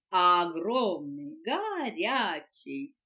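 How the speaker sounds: very slow, stretched-out words; savouring. A narrator's reading. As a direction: draw each word out luxuriously. Boa describing his greeting lovingly. (смакуя, протяжно)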